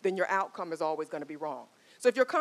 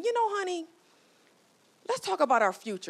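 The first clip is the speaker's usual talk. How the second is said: wife's voice; 11 semitones higher than usual. Retold or imitated. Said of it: "the wife was just saying".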